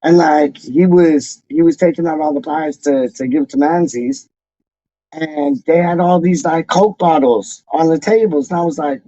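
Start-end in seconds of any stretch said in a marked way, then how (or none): none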